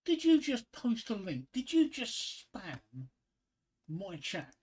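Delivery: loud, high-pitched affronted challenge; indignant disbelief. Real, not acted. Streamer to viewer who just posted a link, indignant disbelief. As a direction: call out the culprit, drawing out the last words.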